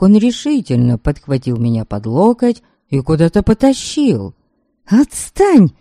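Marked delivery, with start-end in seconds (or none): 4.9-5.7 hissed, high, sharp; whispered fury. A narrator's reading. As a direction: hiss it at him. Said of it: "прошипела я".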